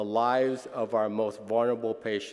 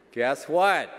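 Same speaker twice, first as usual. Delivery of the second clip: ordinary talk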